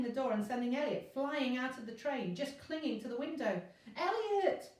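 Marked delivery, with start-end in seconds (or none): 4.0-4.7 very high, loud scream of his name. A narrator's reading. Virgo screaming as Elliot is flung from the train, horrified. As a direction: shriek it as he falls. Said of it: "Virgo screamed".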